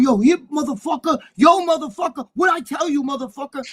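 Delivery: high, shouty imitation of a threatening rival; acted angry confrontation. Rival confronting the big-mouth kid, imitated high angry challenge.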